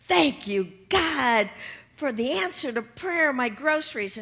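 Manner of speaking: overjoyed woman thanking God aloud; joyful loud thanks. Acted. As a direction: call it out to heaven. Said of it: "she goes".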